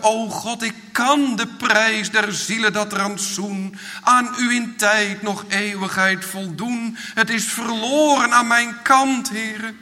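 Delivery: preacher's urgent imitated plea, mild fear; fearful crying out. Sinner crying to God that all is lost, fearful plea. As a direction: cry out in mock-desperate prayer, building to the loss. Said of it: "roep je het in vrezen uit".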